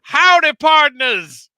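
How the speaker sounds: loud, high hammed-up rodeo cowboy. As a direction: whoop it up like a rodeo host. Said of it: "I can't keep that up".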